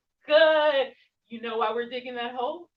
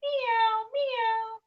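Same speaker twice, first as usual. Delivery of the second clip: high, louder meowing; cat meows; 10 semitones higher, 4 dB louder than usual. Shown as a demonstration. Teacher meowing like a cat in cat pose. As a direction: mew like a cat. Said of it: "like a cat".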